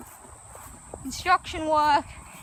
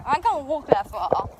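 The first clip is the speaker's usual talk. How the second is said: puppy dog impression. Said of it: "That's my puppy dog impression".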